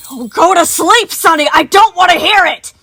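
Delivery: sudden high, full-force scream of rage; enraged yell. A narrator's reading. Moon yells at Sun to shut up, in a burst of rage. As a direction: explode into furious yelling. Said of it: "yelling to nobody in an unexpected burst of rage".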